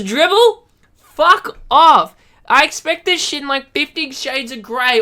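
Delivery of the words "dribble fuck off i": loud, high angry blast; outraged fuck off; furious shout. Real, not acted. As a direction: bellow it in disgust. Ranting at the lyrics.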